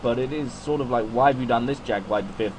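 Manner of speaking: ordinary talk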